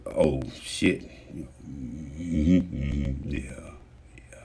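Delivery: low, very soft mumbled agreement; subdued acknowledging. Acted. People who are just like that, subdued murmuring. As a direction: trail down to a near whisper.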